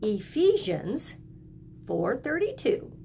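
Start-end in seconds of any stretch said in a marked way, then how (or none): none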